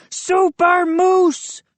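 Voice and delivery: slow, high superhero yell. A narrator's reading. Moose jumping on the table, slow hero yell. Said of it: "he yelled".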